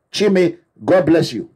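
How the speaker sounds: ordinary talk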